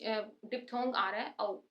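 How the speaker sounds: ordinary talk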